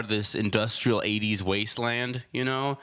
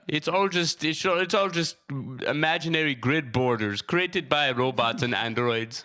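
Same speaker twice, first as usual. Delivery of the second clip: put-on Jamaican accent; 2 semitones higher than usual. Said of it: "Why are you Jamaican now?".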